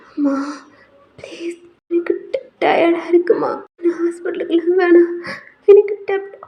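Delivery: high, breathy whimper; weak pleading. Acted. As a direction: feeble sick-girl protest, breaking into whisper. Exhausted sick daughter refusing hospital.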